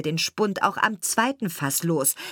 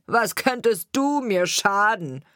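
scornful carter; 4 semitones higher, 4 dB louder than usual. A narrator's reading. Carter scoffs at threat.

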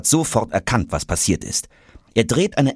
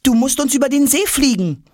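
squeaky high, urging voice; insistent little character. Acted. Traveller urging suitcase to fly them, high and insistent.

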